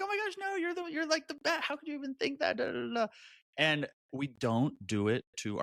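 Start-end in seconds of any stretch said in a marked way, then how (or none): 0.0-3.1 very high, incredulous reassurance; acted high-pitched outrage on a friend's behalf; gushing protest. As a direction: rush to a friend's defence, pitched way up. Voices herself defending best friend.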